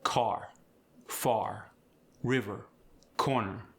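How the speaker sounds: full R in "Car, far, river, corner"; pronounced R. Shown as a demonstration. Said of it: "you pronounce the R".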